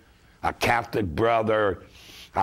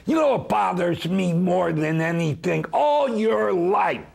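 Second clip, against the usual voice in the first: impassioned TV appeal; 7 semitones higher than usual. Pretend. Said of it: "Do it now".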